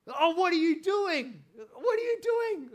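high, loud exasperated shout at the players; frustrated yelling at the TV. Retold or imitated. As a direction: yell it like a fan losing patience with his team. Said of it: "I'll say things like".

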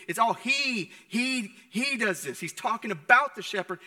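ordinary talk

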